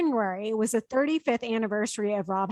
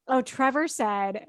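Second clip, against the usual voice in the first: ordinary delivery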